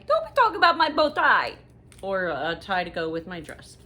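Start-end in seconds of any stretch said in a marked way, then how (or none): 0.0-1.5 husband's defiant retort. Retold or imitated. Voicing husband Jay.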